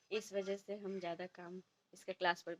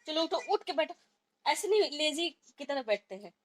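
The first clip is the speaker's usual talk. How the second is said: loud, high scolding; scolding son; 9 semitones higher, 8 dB louder than usual. Real, not acted. Mother scolding her lazy son to sit up properly, loud and high.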